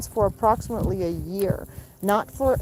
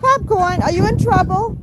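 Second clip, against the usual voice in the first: much higher and louder doting coo; high pet talk; 10 semitones higher, 6 dB louder than usual. Real, not acted. Owner asking the rooster if he's in trouble, high doting pet talk.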